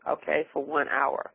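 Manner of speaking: normal talking voice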